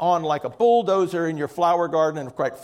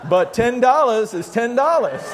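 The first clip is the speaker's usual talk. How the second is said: laughing punchline; 3 semitones higher than usual. Acted.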